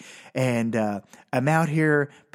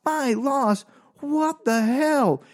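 high, slow incredulous repeat; can't believe what he just heard; squealing indignant what the hell; outraged disbelief; 13 semitones higher, much slower than usual. Retold or imitated. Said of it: "And I'm like".

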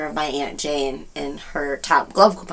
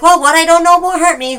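higher, louder mock declaration; mom's motto; 10 semitones higher, 5 dB louder than usual. Daughter quoting her mom's motto, loud and breezy.

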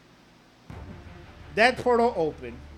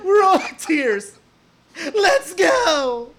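high slow tearful gushing; teary excitement; 7 semitones higher, much slower than usual. Real, not acted. Fan reliving the moment, slow high tearful joy. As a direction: draw it out near tears. Overwhelmed, laughing near tears.

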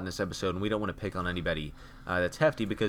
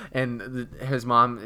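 ordinary delivery

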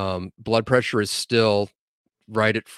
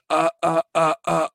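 mock stuck stammering, pitched up; repeated stuck "uh", higher voice; flustered stammer; 6 semitones higher than usual. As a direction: imitate a frozen, flustered kid. Kid freezes, acted stammer.

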